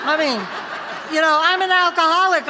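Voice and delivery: loud, high, self-mocking indignation; mock indignant protest. Alcoholic refusing to admit she needs help, mock indignant.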